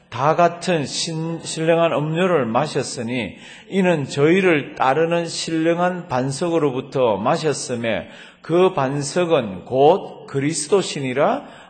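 loud, slow unison scripture reading; scripture read aloud with the congregation, loud and slow; congregational reading aloud. Shown as a demonstration. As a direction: read it out together, slow and strong. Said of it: "다 같이 읽습니다".

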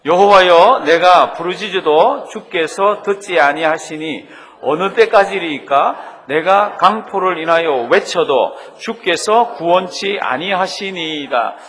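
loud, slow scripture recitation; loud reading aloud. Shown as a demonstration. Preacher leads congregation in the prophet's cry to God, loud reading. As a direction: read it out in a big voice, drawing out the phrases. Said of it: "큰 소리로 한번 읽으십니다".

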